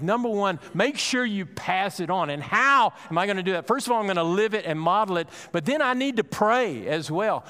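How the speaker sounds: high, loud, ringing preacher's exhortation; full-force preaching. Acted. Pastor urging the congregation to pray for their children, preaching at full force. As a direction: drive the call home to the whole room.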